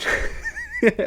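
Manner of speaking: high burst of laughter. Real, not acted. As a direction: crack up at his face. Loud laugh.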